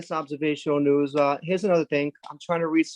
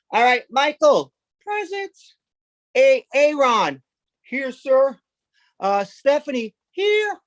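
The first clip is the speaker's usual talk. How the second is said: put-on teacher and kids; 8 semitones higher, slower than usual. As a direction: roll call in a lightly put-on voice. Teacher reads attendance, kids answer.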